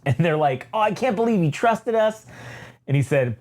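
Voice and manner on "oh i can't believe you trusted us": high, put-on gloating voice; pitched-up jeering; mocking twins. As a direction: taunt in a high mock voice. Twins mock Vader, acted out.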